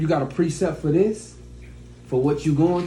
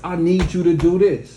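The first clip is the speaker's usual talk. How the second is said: firm, direct command; strong mighty man; 2 semitones higher, 4 dB louder than usual. Acted. Mighty man telling his woman what to do, firm. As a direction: say it like a man who means it. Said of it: "He gonna say".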